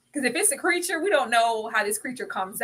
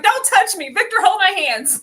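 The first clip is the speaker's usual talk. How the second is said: much higher, louder mocking snap then plea; mock Tabitha; 10 semitones higher, 8 dB louder than usual. Mocking Tabitha rejecting Julie for Victor, loud high imitation. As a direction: mimic her pushing Julie away. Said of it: "she was like".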